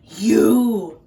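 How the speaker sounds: loud snapping "You"; the wolf pouncing; loud cry. Acted. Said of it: "cried the wolf".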